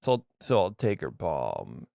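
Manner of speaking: lightly put-on creepy magician, low and slow; a hint of a slow, low-voiced palm reader; David Blaine. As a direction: lightly imitate a creepy illusionist, drawing the words out. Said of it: "it's my David Blaine impression".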